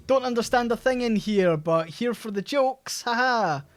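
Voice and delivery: high, loud sing-out parody; mocking online commenter. Commenter on Scottish banter pages, mockingly perky and loud. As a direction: send up a clueless, over-cheery commenter. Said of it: "they'll comment and be like".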